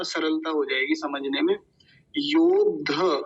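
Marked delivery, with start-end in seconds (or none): 2.2-3.2 "योद्ध" slow, held d then dha; open dha ending. Shown as a demonstration. Teacher contrasts it with last time's word, slow dha ending. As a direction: sound out "योद्ध" as yod-dha. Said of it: "इस बार है योद्ध".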